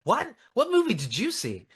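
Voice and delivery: high, disbelieving what; incredulous at the trailer blurb. Retold or imitated. Reacting to the trailer quote, high disbelief. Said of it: "And I'm like".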